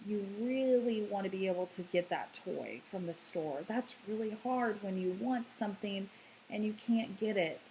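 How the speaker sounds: put-on calmly name the child's feelings, tender and quiet; low calm validating tone. Shown as a demonstration. Parent validating a child who wanted a toy, soft and tender. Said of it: "to have a lower tone and say".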